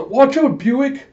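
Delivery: high, loud warning cry; alarmed shout. Retold or imitated. Koyomi warning Buick before the shot, alarmed shout.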